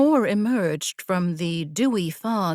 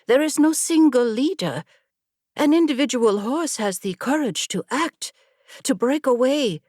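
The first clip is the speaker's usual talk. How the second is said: passionate; 6 semitones higher than usual. A narrator's reading. Kiora lecturing her friend about the herd, carried away with passion. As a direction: build it up with fervor.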